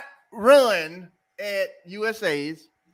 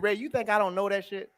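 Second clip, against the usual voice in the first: quoting coach; 5 semitones lower, 9 dB quieter than usual. Retold or imitated. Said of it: "He said it just like that".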